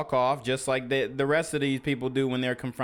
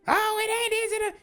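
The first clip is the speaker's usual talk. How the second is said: jumps far higher, quick mock yell; a hammed-up high-pitched protest; mock shrill outburst; faster than usual. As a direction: squeal it like a caught-out worker. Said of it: "you yelling, you screaming".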